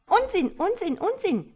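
mock the old frog with high shrieks; young frogs jeering. A narrator's reading. Cheeky young frogs jeering at their mother, high and loud. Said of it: "schrien sie".

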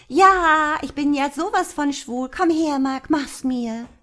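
high, loud, campy mock come-on; giggly mock flirt. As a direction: tease him with a shrill, giggling come-on.

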